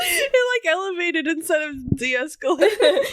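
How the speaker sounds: high, laughing through words. Real, not acted. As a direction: crack up mid-sentence.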